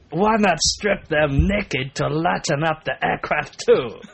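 loud, slow hammed-up French; muppet-like French accent. Reading a French woman's comment aloud, muppet-like French accent. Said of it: "I just sound like a muppet now".

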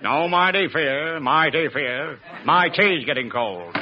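very slow, drawn-out mock-American drawl; low, stretched American accent; Bob Hope. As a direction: drawl it out like a comic American westerner. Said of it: "my impersonation of Bob Hope talking English".

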